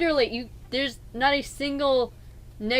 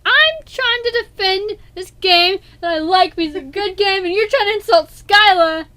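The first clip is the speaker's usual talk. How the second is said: raised, higher arguing voice; exasperated, loud defence; heated protest; 6 semitones higher, 6 dB louder than usual. Real, not acted. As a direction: argue it loudly and emphatically.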